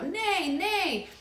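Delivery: put-on a small child neighing like a horse; toddler's horse neigh. Toddler naming the horse piece, high neigh. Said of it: "they know the horse and they go".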